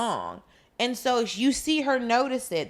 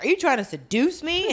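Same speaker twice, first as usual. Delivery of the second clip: higher-pitched offended question; mock indignation; 7 semitones higher, 4 dB louder than usual. Village chief upset by a nose-rub greeting, mock indignant. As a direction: play the affronted chief, hammed up. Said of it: "village chief is so upset".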